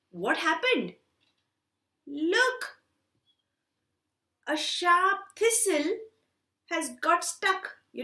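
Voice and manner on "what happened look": usual speaking voice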